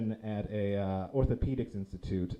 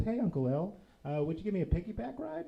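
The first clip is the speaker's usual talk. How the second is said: lightly voiced six-year-old, pitched up; young niece; 8 semitones higher than usual. Six-year-old niece asking her uncle for a piggyback, lightly childlike. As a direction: ask sweetly like a little kid. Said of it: "She's six years old".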